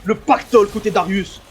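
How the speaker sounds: high-pitched play-by-play; esports caster. Real, not acted. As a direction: cast the action live. Live play-by-play casting.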